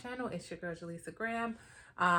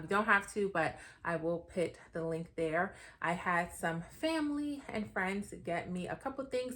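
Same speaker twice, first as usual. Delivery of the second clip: ordinary talk, nothing marked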